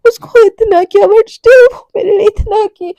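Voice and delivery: high, loud sobbing plea with catches in the voice; sobbing confession. Acted. Daughter sobbing to her mother after hurting her friend, crying. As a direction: break down crying to your mother. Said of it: "ऐसे बोल के ना ... रो रही होती है".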